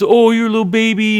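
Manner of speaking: mock the smaller kid from above; bully taunt. Bully taunting the smaller kid on the ground, sneering high.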